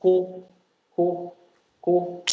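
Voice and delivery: Ko said with a hard aspirated k; Ko, ko, ko with a crisp k; 1 strong k sound. Shown as a demonstration. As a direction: Korean ko with a strong k. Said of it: "That's why it's k".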